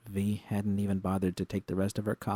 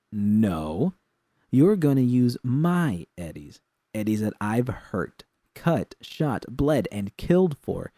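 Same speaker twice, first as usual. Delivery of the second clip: louder, slowed furious rant; angry outburst; 6 semitones higher, 8 dB louder than usual. A narrator's reading. V erupts at Jude over her money, furious. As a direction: let the anger spill over, hitting each word. V's face turned red.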